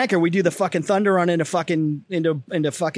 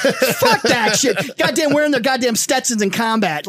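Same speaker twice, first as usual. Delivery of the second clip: higher-pitched, laughing rant; comic outrage; 4 semitones higher, slower than usual. Real, not acted. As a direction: rail against it in amused disgust. Comic rant about disliked movie.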